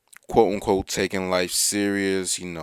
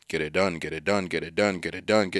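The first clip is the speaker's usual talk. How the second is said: monotonous and dull; 3 semitones lower than usual. Retold or imitated. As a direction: drone get it done, lifeless and robotic. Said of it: "more monotonous and more dull".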